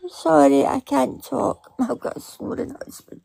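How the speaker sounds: ordinary talk, nothing marked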